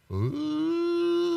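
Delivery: long high whale moan; whale call imitation. Preacher mimics the whale in the recording, long high moan.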